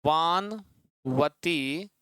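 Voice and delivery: वानवती stretched very slowly, syllable by syllable; drawn-out sound. Shown as a demonstration. Teacher stretching वानवती so students hear the sound, very slow. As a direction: spell out वानवती slowly for the class. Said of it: "कैसी ध्वनि आए?".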